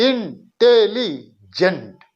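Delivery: Intelligent very slowly, every syllable set apart. Shown as a demonstration. Teacher counting syllables for learners, intelligent broken up very slowly. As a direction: stretch intelligent out with breaks between the pieces. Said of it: "देखिए तीन syllable हो गया".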